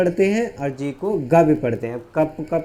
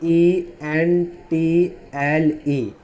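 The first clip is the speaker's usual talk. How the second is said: letters drawn out very slowly; slow spelling out; much slower than usual. Shown as a demonstration. Teacher spelling out slowly while writing. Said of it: "मैंने लिखा देखो".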